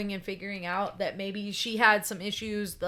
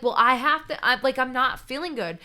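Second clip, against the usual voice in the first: upset complaint; 5 semitones higher, 9 dB louder than usual. Retold or imitated. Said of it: "she was like".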